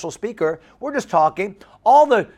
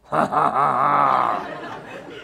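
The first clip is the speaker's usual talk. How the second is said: long goofy put-on laugh, trailing down; imitated toothy laugh; 4 semitones lower than usual. Youth pastor mimicking buck-toothed camper, goofy laugh. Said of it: "that's why he looked like that".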